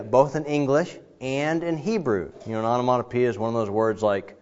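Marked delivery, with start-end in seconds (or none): none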